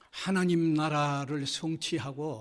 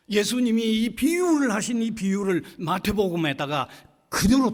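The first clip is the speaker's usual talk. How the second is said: raised pulpit voice; preacher pressing each phrase home; emphatic preaching; 3 semitones higher, 6 dB louder than usual. Real, not acted. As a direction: proclaim it forcefully to the congregation.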